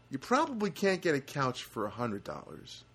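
ordinary talk

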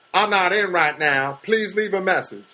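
slow, lightly put-on character voice; a hint of a character on an answering machine. Comic recording his answering machine greeting in a lightly put-on voice. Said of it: "I was doing different characters".

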